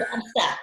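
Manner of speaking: "Lobster" with no R, lobstah; "Lobster" said as lobstah, R dropped; Boston lobster. Shown as a demonstration. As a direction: drop the R in "Lobster" like a New Englander. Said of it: "I was waiting for you to say lobster".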